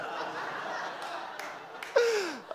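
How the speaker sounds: high airy burst of laughter; breathy laugh. Real, not acted. Laughing at his own story before the congregation, breathy laugh.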